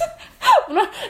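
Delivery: high burst of real laughter. Real, not acted. Laughing at the surveyor's hardware mix-up, high real laugh.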